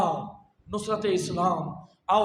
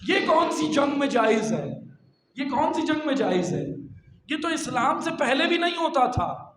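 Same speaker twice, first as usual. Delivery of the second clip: raised, forceful rhetorical questioning; indignant preaching; 4 semitones higher, 4 dB louder than usual. Acted. Preacher decrying violence against children, indignant. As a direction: hammer the outrage home to the congregation.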